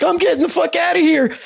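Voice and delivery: high-pitched frantic character voice; panicked character. Acted. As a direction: squeal it like a comic coward fleeing.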